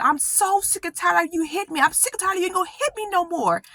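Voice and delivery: high, fierce fed-up warning; fed-up threat. Retold or imitated. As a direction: warn him off with high-pitched fury. Confronting abuser holding a knife.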